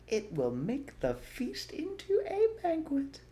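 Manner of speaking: gloating fox father; Mister Fox voice. A narrator's reading.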